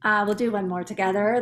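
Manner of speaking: usual speaking voice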